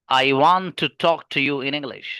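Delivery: slow, connected delivery with a punched "want"; fluent native flow. Shown as a demonstration. Teacher models English in its natural rhythm, stress on "want". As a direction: stress "want" and glide the rest together. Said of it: "कुछ फर्क लग रहा है?".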